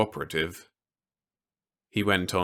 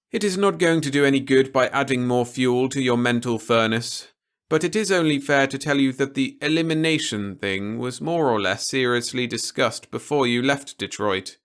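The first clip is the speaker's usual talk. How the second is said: a lightly raised, measured officer's voice; stern officer; 6 semitones higher, 5 dB louder than usual. A narrator's reading. Officer warning Peter against anything rash, lightly voiced and grave. Officer warns about elimination.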